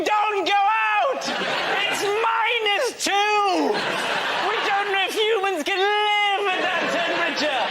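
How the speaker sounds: hammed-up doom warning, high and drawn out; alarmist BBC warning. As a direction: wail a slow, high-pitched mock emergency broadcast. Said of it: "the B B C were going".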